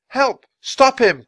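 high, loud shout for help; crying out. Acted. Boy robbed of his bag, crying out for help. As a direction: call out urgently, voice leaping up. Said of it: "cried Jack".

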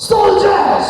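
shouted high and loud like a drill call. Shown as a demonstration. Teacher shows the children how loudly to answer, shouting. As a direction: belt it out so the kids answer loudly. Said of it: "ছোটদের আরো জোরে বলো".

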